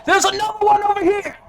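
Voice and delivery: very high, loud, fast shout; distant yell. Acted. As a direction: shout to the crowd across the square. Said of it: "you hear someone yell".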